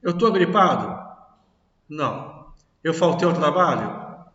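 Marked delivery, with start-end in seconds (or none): none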